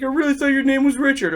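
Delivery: high, quick mock innocent voice; sarcastic impression of the coach; mocking the other coach. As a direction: play dumb in a high, fast voice. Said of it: "he's like".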